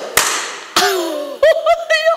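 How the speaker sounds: high burst of laughter; hearty laugh. Real, not acted. Laughed at the question.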